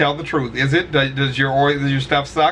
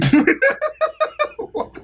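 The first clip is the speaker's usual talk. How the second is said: high-pitched burst of laughter. Real, not acted. Laughing at dark joke.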